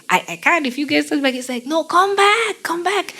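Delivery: high, eager mock old man; light caricature of a keen old fan; imitating an old Brazilian man. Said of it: "he barely even had teeth".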